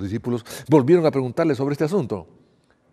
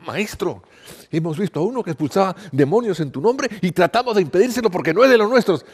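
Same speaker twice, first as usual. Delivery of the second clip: higher, urgent disciple voice; eager disciple John; 7 semitones higher, 5 dB louder than usual. Acted. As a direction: report it excitedly to the teacher. John reports to Jesus.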